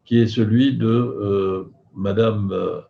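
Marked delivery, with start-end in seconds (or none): none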